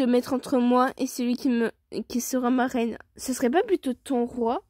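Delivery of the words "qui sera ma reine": ordinary delivery, nothing marked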